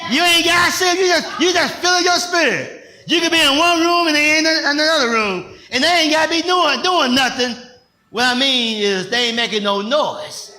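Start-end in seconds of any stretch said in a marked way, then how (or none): 0.1-7.6 raised, loud preacher's cry; preaching shout. Real, not acted. Preacher crying out to the congregation, loud and high. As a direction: lift it high and loud to the church.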